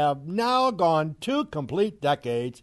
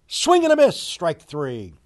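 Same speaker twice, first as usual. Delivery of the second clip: play-by-play announcer; 3 semitones higher, 6 dB louder than usual. Retold or imitated. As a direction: belt it like the booth announcer. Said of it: "if you're doing play-by-play".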